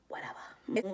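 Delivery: breathy, dismissive brush-off; whispered whatever. Real, not acted. Dismissing the whole argument, whispered and unbothered. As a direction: let it go with a voiceless sigh.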